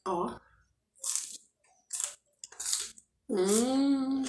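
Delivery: chewing while talking; speaking around leafy mouthful; mouth full of greens. Real, not acted. Seen 0: Forks watercress into mouth, then chews with leaves visible.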